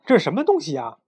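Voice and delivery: high, loud startled call; surprised shout. A narrator's reading. Captain sees a strange creature, surprised shout. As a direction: shout out in astonishment. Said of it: "巴克队长大叫说".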